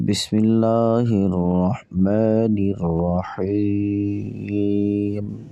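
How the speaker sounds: slow, drawn-out chanted Bismillah; Quran recitation. Shown as a demonstration. Teacher opens the lesson for students with slow chanted recitation. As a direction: intone it slowly like opening a recitation.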